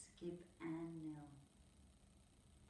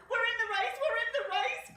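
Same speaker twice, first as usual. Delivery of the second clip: very high, much louder gleeful cry with a laugh; Nell crying out; 10 dB louder than usual. Acted. Nell celebrating joining the race, high loud gleeful cry. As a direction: shriek with joy. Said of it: "cried little Nell".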